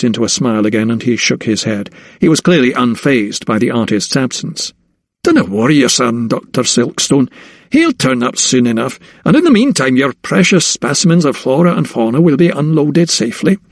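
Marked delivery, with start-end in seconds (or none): 5.2-13.6 easy, smiling Scottish brogue; reassuring Scottish captain. Acted. Captain reassuring the anxious doctor, smiling Scottish brogue. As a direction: reassure him like an unfazed Scots skipper.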